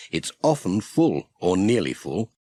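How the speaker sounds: ordinary delivery, nothing marked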